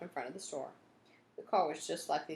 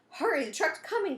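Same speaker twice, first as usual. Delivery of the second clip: urgent warning; 13 semitones higher, 8 dB louder than usual. Acted. Truck chasing them.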